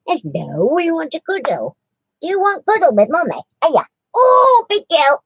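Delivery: high, sing-song cooing at the dog. Real, not acted. Owner coos to her cuddly dog, high baby talk.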